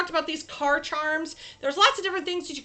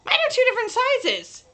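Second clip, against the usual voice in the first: surprised; 9 semitones higher than usual. Retold or imitated. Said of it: "and I went".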